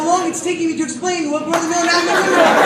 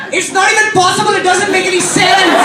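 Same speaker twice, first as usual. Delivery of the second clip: higher, louder hammed-up sobbing voice; mock weeping; 6 semitones higher, 7 dB louder than usual. Cousin crushed and weeping, hammed-up sobbing. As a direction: cry it out in disbelief. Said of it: "all she said was".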